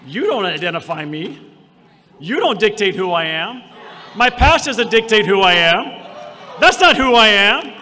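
rising to a shouted climax; preaching at full force. Acted. Pastor to the congregation, building to a shout.

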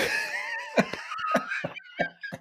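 very high wheezing laugh; helpless laughter. Real, not acted. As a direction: crack up completely at yourself. Cracks up at own confession.

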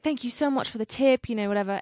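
lightly put-on English traveller accent; imitated British accent. Camper thanks them for the tip, imitated British accent. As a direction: imitate his grateful British lilt. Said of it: "he has an accent".

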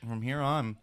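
talking with a chewable in mouth; words round a chewing mouthful; chewing while talking. Real, not acted. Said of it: "I'm chewing this".

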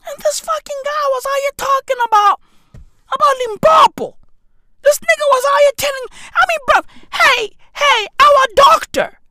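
high-pitched, loud, rapid outburst; outraged rant. Retold or imitated. Patient retelling a doctor visit, outraged high shouting. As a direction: rant incredulously at the top of your range.

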